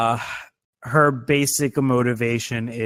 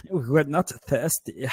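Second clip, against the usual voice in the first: campy German villain; 2 semitones higher, much slower than usual. Retold or imitated. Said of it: "the German guy is like".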